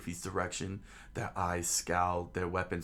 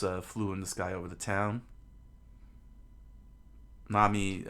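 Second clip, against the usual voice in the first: ordinary delivery, nothing marked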